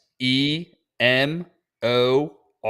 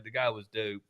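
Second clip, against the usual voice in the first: ordinary talk, nothing marked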